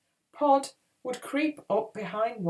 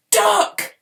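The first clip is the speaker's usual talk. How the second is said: very high, loud warning; screaming; 13 dB louder than usual. A narrator's reading. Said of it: "Podkin screamed".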